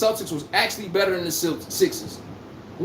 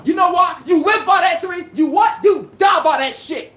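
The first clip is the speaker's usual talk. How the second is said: loud, higher rant; heated shouting; 6 semitones higher, 4 dB louder than usual. Real, not acted. Ranting at Boston fans, shouting. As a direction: shout the punchline at them.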